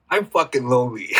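admitting it through a laugh; half-laughing confession. Real, not acted. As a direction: let the laugh break through the words. Admits own loneliness as punchline.

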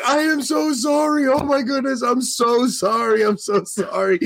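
high, pleading apology; retold frantic apology to a stranger; apologetic gushing. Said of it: "I'm like".

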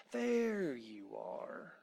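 breathy unvoiced whisper. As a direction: breathe it, no voice at all. Wolf woman appears behind Tom.

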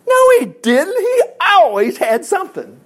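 high, loud burst of correction; emphatic contradiction. Real, not acted. Preacher overturning what he just told the congregation, emphatic contradiction. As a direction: jump in high and insistent, stretching it out.

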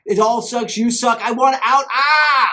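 building from loud to a full shout; screaming frustration at the end; inner scream building. Retold or imitated. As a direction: boil over into a frustrated yell. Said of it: "the voice in my head is screaming".

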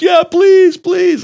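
loud, squealed high plea; hammed-up pleading squeal; mock begging. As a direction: imitate a terrified kid begging, way up high. Mocking the kid's frightened begging.

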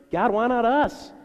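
loud, high cry to God; frustrated plea. Retold or imitated. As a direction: plead upward, drawing out the words. Anguished plea after infertility.

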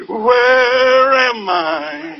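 slow, drawn-out wailing cry of a lost woman; dazed amnesia patient. Retold or imitated. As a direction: moan it like a sleepwalker who lost herself. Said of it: "walk around with her arms in front of her saying".

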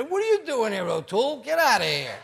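high, loud mock shout at a guest; playful bark of get out. Husband jokingly shouting at the guest to leave, loud mock shout.

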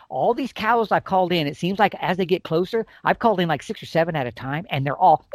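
ordinary delivery

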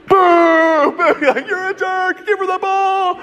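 high, drawn-out mock booing; jeering crowd. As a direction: imitate a hostile stadium heckling. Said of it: "just turned on him".

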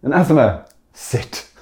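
calling the dog to sit; mock dog command. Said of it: "We need to call out my doggy".

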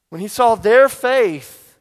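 slow and loud, stress on their; emphatic preaching. Acted. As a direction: hammer their faith home. Climactic repeat of the line.

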